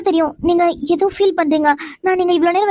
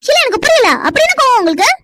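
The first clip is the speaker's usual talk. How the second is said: indignant outburst; 6 semitones higher than usual. Acted. Wife confronting her husband over his angry threat, indignant and raised. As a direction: throw the question back at him, incensed.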